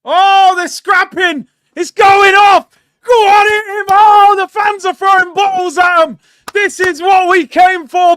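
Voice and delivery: high, loud shouts as the fight erupts; excited shouting. Real, not acted. Fan watching players scrap and bottles fly, excited shouting. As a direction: yell it like a fan egging on a brawl.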